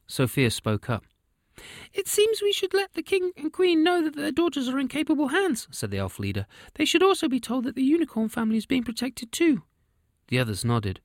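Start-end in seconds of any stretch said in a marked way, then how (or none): 1.9-5.7 very high, squeaky elf leader voice; a chirpy little elf in charge; christmas elf. A narrator's reading. Elf leader Sophia speaks. 6.7-9.6 very high, squeaky elf leader voice; a chirpy little elf in charge; christmas elf. A narrator's reading. Elf leader Sophia speaks.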